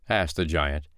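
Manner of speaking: plain reading voice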